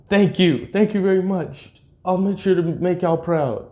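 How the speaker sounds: high-pitched, lightly imitated earnest thanks; grateful kid. Kid accepts the heirloom from the mentor, grateful. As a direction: voice a thankful kid well above your own pitch.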